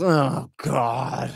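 long drawn-out groaned imitation; weary, put-on audience groan; mock crowd groan. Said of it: "the crowd just groans".

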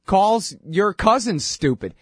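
ordinary delivery

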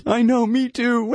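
high, mocking fake empathy; mock crying along. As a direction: whine back at them.

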